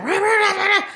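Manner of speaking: loud, high-pitched rah-rah mimicry; mock yelling over someone. Interrupted speaker mimics someone shouting over her, mock yelling. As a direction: imitate someone yapping loudly over you. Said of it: "you're just like".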